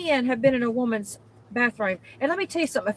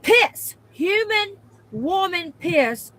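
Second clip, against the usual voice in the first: outraged disgust; 3 semitones higher, 4 dB louder, much slower than usual. Real, not acted. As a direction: drag it out, horrified. Outraged retelling of splatter.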